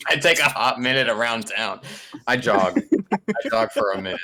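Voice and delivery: ordinary talk, nothing marked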